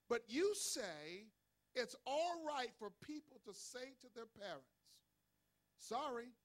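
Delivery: slow, weighty preacher's delivery; dramatic scripture reading. Acted. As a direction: leave each phrase hanging deliberately. Reads scripture aloud.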